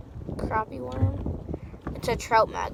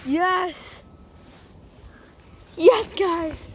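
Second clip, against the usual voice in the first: excited; 6 semitones higher, much slower than usual. Real, not acted. Celebrating the catch.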